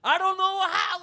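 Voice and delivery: very loud, high yell. Retold or imitated. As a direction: belt it out, exasperated. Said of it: "Then I hear".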